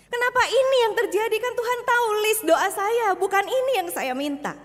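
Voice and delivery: high, loud, fast pleading at God; anguished protest. Acted. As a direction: demand answers from God. Preacher voices despairing believer. (protes kepada Tuhan, tinggi dan keras)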